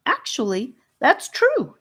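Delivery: high, eager chime-in; piping up. A narrator's reading. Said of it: "Charlie piped up".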